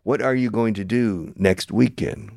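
"are you going to" carefully in full; textbook-careful "What are you going to do"; unreduced form. Shown as a demonstration. Teacher mocks textbook English, "are you going to" in full. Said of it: "Nobody in the history of the English language has said".

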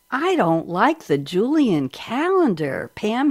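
slow, swooping mock complaint; imitated fussy pope. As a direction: play a pope grumbling, drawn right out. Voices the pope's decision.

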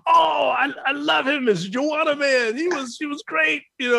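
high, excited mock fan. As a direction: gush like a starstruck fan. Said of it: "he says".

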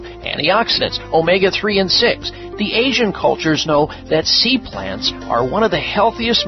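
radio ad read over music; infomercial. Acted. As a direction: pitch the product to listeners. Host reads his own ad.

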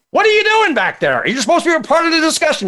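high-pitched, fast, hammed-up annoyed call to the doodler; irritated bankers. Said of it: "they got really irritated".

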